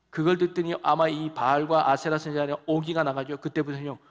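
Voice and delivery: normal talking voice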